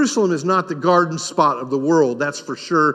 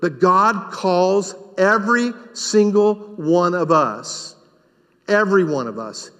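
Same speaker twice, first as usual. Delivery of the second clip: ordinary talk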